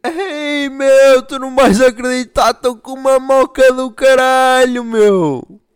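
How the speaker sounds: loud, high fake-drunk voice. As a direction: brag about being wasted, high and loud. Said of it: "mas quer dizer que está bêbado".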